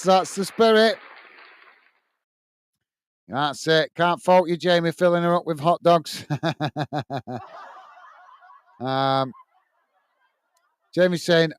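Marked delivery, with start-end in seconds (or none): 6.3-7.4 soft chuckle. Real, not acted. Reacting to chat joke.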